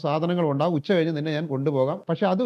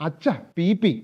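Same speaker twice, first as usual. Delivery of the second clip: slightly raised voice; slower than usual. Acted. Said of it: "അല്പം കൂടി ശബ്ദം ഉയർത്തി പറഞ്ഞു".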